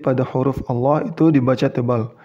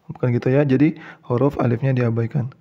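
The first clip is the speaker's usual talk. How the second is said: usual speaking voice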